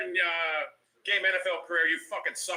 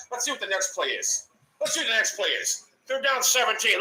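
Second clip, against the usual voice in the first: ordinary delivery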